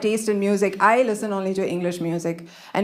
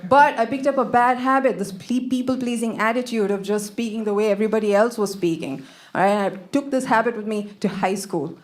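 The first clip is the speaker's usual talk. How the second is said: normal talking voice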